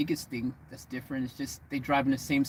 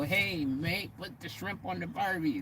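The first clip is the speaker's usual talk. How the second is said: "put the shrimp on the Barbie" in a higher, hammed-up movie Australian accent; mock Aussie accent; 4 semitones higher, 4 dB quieter than usual. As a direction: parody the Crocodile Dundee Aussie line. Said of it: "No Aussie person would ever go".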